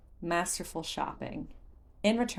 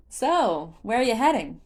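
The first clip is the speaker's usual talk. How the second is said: lively merchant; 5 semitones higher, 7 dB louder than usual. A narrator's reading. Beetle the merchant speaks.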